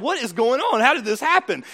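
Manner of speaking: acted-out exasperated disbelief; bewildered outcry. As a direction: exclaim it like a baffled parent. Parent shocked at own behavior.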